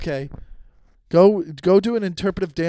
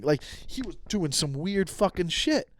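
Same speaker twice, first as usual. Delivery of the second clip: ordinary talk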